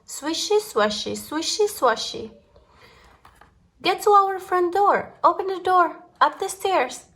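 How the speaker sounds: ordinary delivery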